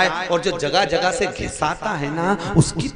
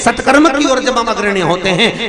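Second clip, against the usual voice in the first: higher, louder rolling sermon; full-force preaching; 6 semitones higher, 10 dB louder than usual. Acted. Preacher rouses the crowd, higher and louder. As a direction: preach it out to the hall.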